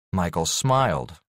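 plain reading voice

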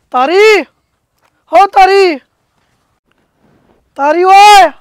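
calling out. Acted. Farmer hailing his friend Tari across the field, loud call.

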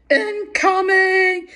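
high, loud, drawn-out lookout call; long high holler to warn the group. Retold or imitated. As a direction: sound the alarm, stretching it out. Calling out warning to friends.

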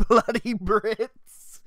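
slow, laughing mock British grumble. Streamer mocks his own British accent, laughing. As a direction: drag it out in a jokey British accent. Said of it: "I take offense to that accent".

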